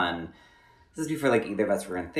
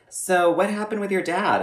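higher, lightly voiced therapist; 10 semitones higher than usual. Retelling her therapist's probing question, lightly voiced higher. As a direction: ask it like a gentle therapist.

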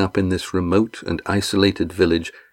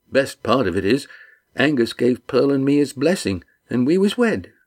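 higher, bluff dialect greeting; a big man delighted to see friends; hearty fisherman; 6 semitones higher than usual. Acted. Red Ian greeting the crew, hearty and glad.

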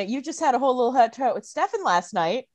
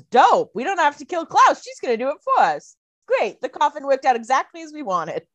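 loud, high gloating cheer; mock gleeful Damon; 6 semitones higher, 3 dB louder than usual. Loud, higher gleeful Damon celebrating Klaus's doom. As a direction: Damon crowing that Esther does the dirty work. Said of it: "Damon says".

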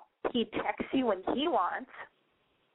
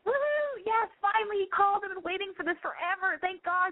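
high, giddy cheer; ego cheering; 9 semitones higher than usual. Retold or imitated. Host voicing the caller's ego, high giddy cheering. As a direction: celebrate like the wait is over. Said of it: "your ego's like".

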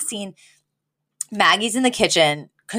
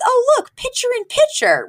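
delighted Val. Retold or imitated. Val finding picture in picture on the TV, delighted and high. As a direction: call it out like a thrilled discovery. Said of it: "she goes".

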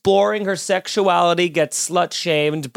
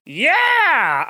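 cheering whoop; 9 semitones higher than usual. Real, not acted. Cheering the punch.

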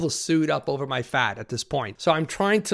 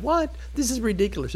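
incredulous; 9 semitones higher than usual. Retold or imitated. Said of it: "I'm thinking".

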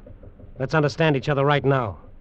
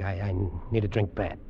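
low, soft, stammered plea; faltering, drained and lower; subdued desperation; 6 semitones lower, 5 dB quieter than usual. Acted. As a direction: sink into a weak, needy admission. Same plea repeated, sinking.

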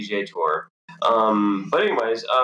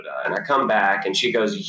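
ordinary talk, nothing marked

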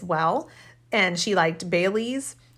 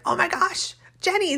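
high squeaky mock dismay; high-pitched mock horror at a burned tablecloth; retold shocked gasp; 12 semitones higher than usual. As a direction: squeal it like a family teasing someone. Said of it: "aunt, and I were like".

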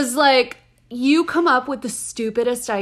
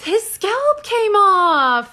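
horrified disbelief; 11 semitones higher, 5 dB louder, much slower than usual. Real, not acted. Horrified at the news report.

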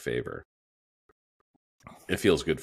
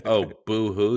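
fake crying; 6 semitones higher, 5 dB louder, slower than usual. Mocking a sob story.

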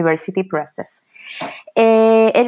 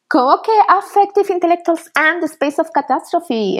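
incredulous; 9 semitones higher than usual. Real, not acted. Incredulous at the imposed title.

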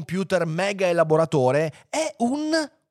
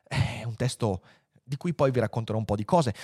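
ordinary delivery

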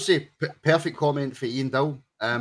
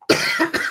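coughing fit; 5 dB louder than usual. Real, not acted. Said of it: "before I get any more croaky".

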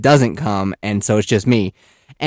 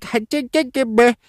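put-on high-pitched baby gibberish; imitating a babbling toddler; toddler babble; 12 semitones higher than usual. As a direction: babble like a little one.

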